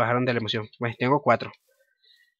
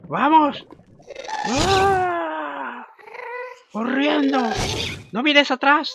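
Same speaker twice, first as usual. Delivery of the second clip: being chased; 14 semitones higher than usual. Real, not acted. Dragon chasing him in game.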